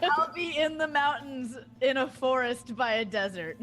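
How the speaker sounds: mock answer. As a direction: ham up the jumbled answer. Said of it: "It's like".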